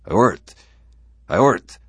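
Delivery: loud, high burst of a strange word; startled outburst. Acted. Bittering blurting an unknown Martian word, loud and high. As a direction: blurt it out loudly, then repeat.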